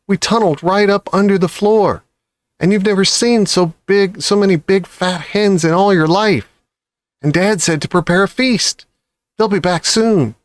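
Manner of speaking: high, eager tumbling report; excited child. A narrator's reading. Cub telling his mother the story, high and eager. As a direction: pour out the news. Cub breathlessly tells news.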